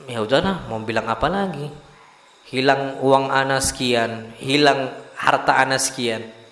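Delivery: acted shrug of acceptance, voice dropped right down; quiet resignation. Preacher models accepting lost money and property, quietly resigned.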